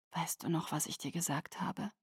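very soft, tender near-whisper; tender hush. A narrator's reading. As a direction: softly remind a child. Mother tenderly to child.

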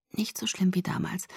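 soft whispered self-comfort; inner whisper. A narrator's reading. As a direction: whisper it to calm yourself. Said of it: "versuchten meine Gedanken, mich zu trösten". (tröstend geflüstert)

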